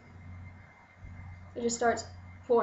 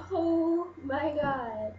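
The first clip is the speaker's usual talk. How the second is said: awestruck gasp; 5 semitones higher, much slower than usual. Retold or imitated. Inner thought during the kiss.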